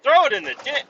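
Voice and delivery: high loud disbelieving echo; outraged disbelief. Retold or imitated. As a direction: repeat it in shock, high and loud.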